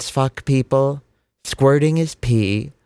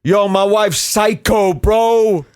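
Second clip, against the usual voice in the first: comic bro voice; 8 semitones higher, slower than usual. Retold or imitated. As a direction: ham up a dumb bro. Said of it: "relegated your wife to".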